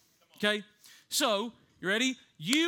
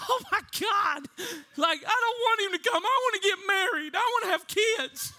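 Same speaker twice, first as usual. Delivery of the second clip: high, whining mock despair; panicked kid; 9 semitones higher, 4 dB louder than usual. Kid dreading the rapture, tearful mock panic. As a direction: wail like a kid dreading doomsday.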